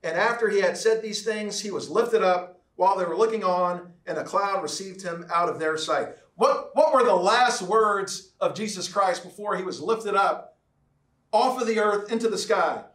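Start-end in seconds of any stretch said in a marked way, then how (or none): none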